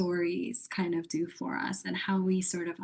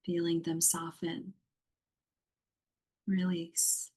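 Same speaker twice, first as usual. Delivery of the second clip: guided meditation. Acted. Yoga teacher guiding a muted online group into yoga nidra, meditative. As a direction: lead them gently into rest, phrase by phrase.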